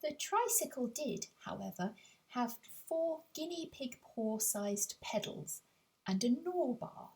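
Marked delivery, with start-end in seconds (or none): none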